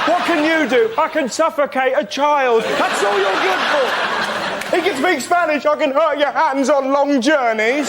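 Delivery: ranting at a shopping bag, half laughing; mock berating. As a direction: scold the bag like a useless servant.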